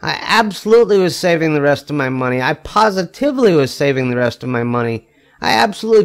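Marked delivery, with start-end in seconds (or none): none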